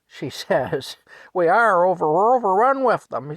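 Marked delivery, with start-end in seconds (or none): 1.3-3.4 high, very loud outburst; worked-up shouting; yelling wife. Retold or imitated. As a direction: holler it at your husband in exasperation. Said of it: "she yells at Frank".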